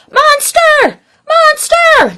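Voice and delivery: high, loud cheer. Acted. The rascals cheer after Monster, high and loud. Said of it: "they cheered after him".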